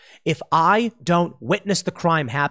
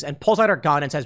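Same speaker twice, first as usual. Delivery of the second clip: usual speaking voice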